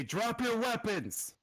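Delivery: high, slow, drawn-out order; called-out command. Acted. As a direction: call it out as an armed challenger. Game master voices armed challenger's order.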